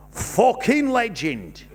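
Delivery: put-on drawn-out generic northern accent. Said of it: "I think I've figured out the one I want".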